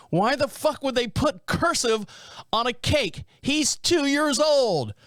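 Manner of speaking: high, slowing mock yell; retold scream. Woman yelling at the florist about the toddler's cake, mock scream. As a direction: drag out two years old in outrage. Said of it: "The woman screamed at me and said".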